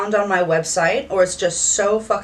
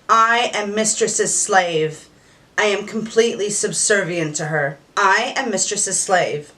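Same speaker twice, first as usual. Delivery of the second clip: with conviction. Shown as a demonstration. Mistress showing a submissive how to repeat it, with conviction. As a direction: declare it proudly, like you believe it completely. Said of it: "I want you to say it with conviction".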